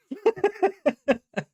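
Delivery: squeaky high burst of laughter. Real, not acted. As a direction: let out a shrill giggle. Laughing at the host.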